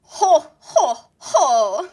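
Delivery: loud, laughing villain ho ho ho; Japanese villain laugh. Shown as a demonstration. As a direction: cackle like an anime villain. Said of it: "that's my Japanese villain laugh".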